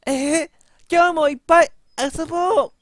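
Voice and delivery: very high, cute doll voice. Acted. Voicing game character.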